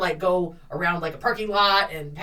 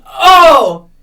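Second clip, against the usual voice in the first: put-on drunk guy's confusion; 11 dB louder, much slower than usual. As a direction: play the clueless drunk guy caught out. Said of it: "And he goes".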